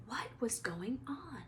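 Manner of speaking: soft unvoiced question; whispered. A narrator's reading. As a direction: wonder it in a whisper. Harry's worried thought.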